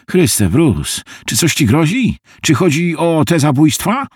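a frightened rush of questions; alarmed friend. Acted. Harvey fears Bruce is in danger, alarmed.

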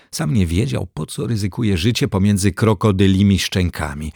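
ordinary delivery

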